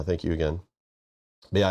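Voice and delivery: ordinary talk, nothing marked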